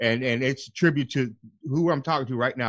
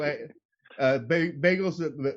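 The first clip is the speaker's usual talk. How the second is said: ordinary talk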